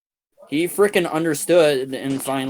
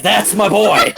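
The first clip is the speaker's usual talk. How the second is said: higher, louder, laughing it out; laughing proud dad; 6 semitones higher, 5 dB louder than usual. Retold or imitated. Stepfather praising the narrator, laughing and pitched up. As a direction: laugh through it like a proud father.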